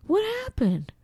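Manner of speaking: high, bewildered "What happened?"; baffled high voice. Retold or imitated. To her husband, mimicking her own confused question, high and baffled. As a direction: squeak it out, totally lost. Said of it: "How many times a day do you hear me say".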